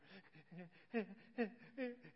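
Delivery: high, gasping mock sobs; hammed-up hitching cry of a child; imitated child's sobbing. Daughter starting to cry at the doctor's, mock gasping sobs. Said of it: "once she starts doing her".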